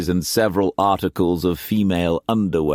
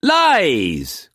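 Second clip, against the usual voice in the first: howled; 6 semitones higher than usual. A narrator's reading. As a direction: howl it in outrage. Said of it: "Quance howled".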